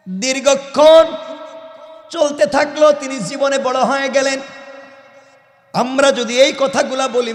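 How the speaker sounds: high, loud chanted sermon; full-force preaching. Real, not acted. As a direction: belt it out on a high sustained preaching note.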